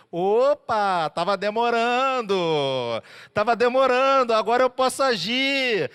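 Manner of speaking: God relieved to be asked at last; loud cheerful "about time"; eager God. Retold or imitated. God voiced, pleased.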